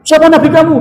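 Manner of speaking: loud, very high, stern questioning; harsh angel question. Retold or imitated. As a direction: fire the question like an interrogating angel. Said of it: "semua dengan nada yang keras". (malaikat bertanya dengan nada keras)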